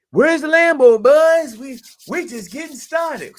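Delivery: high, loud mock hype shout; hyped crowd. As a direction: yell it like a pumped crypto bro. Hyped crypto crowd quote.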